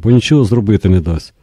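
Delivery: usual speaking voice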